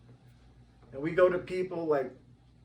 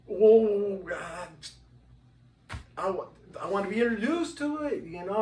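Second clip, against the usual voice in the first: high, louder, changed voice; hesitant wish to be introduced; lightly mocking his own shy ask; coy request; 7 semitones higher, 7 dB louder than usual.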